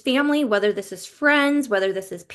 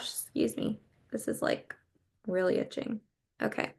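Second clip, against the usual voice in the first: softer, half-whispered aside; quiet aside; 9 dB quieter than usual. Real, not acted. Reader apologizes to viewers for her itching eye, softly. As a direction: drop the voice to a near whisper for a quick aside.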